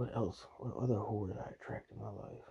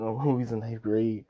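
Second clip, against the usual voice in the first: ordinary talk, nothing marked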